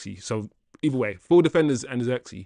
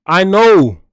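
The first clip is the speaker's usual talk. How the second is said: loud agreement; 4 semitones higher, 11 dB louder than usual. Real, not acted. Agreeing loudly with chat.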